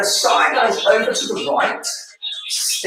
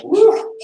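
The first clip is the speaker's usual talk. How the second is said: excited whoop; 4 dB louder than usual. Real, not acted. Wobbly balance move.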